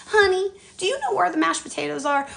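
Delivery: high-pitched imitation of a senior shopper; senior citizen. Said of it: "it was Senior Citizen Day".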